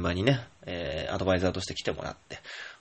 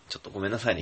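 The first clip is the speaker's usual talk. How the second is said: ordinary talk, nothing marked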